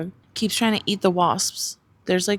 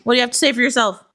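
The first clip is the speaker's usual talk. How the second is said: mock scolding; 4 semitones higher, 7 dB louder, much faster than usual. Real, not acted. Fast playful scolding of dog.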